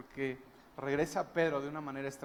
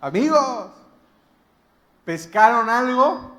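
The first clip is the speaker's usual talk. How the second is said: loud, high, drawn-out holler; shouted call from afar; 8 semitones higher, 15 dB louder, slower than usual. Acted. Jesus calling to the fishermen from the beach, long shout. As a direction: shout slowly across the water. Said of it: "les grita".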